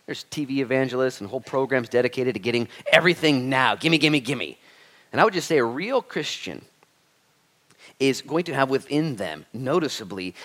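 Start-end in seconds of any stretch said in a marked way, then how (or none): none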